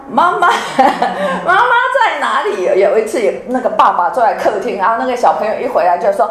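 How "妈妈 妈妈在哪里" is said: put-on high, eager kid's call breaking into a laugh; imitating a child shouting for mom, giggling. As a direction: play the kid bursting through the door for mommy.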